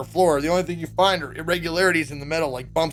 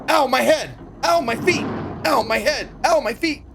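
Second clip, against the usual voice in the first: higher, louder singsong whining ows; Futurama bit; 8 semitones higher, 3 dB louder than usual. Retold or imitated. Imitating the Futurama gag of a bumped, whining captive. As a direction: whine each ow like a cartoon. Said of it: "like Futurama".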